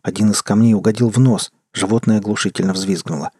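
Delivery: plain reading voice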